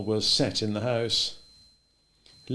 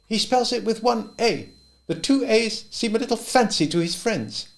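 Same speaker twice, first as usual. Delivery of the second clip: higher, lightly voiced servant, amused; Lee amused; 13 semitones higher, 4 dB louder than usual. A narrator's reading. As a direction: Lee explaining with a chuckle. Lee's voice in the story.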